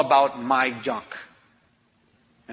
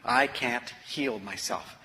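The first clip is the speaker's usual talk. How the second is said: slow and softer, letting it land; quiet admission; 4 semitones lower, 7 dB quieter, slower than usual. Real, not acted. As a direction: confess it slowly, hushed. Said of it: "And I finally knew".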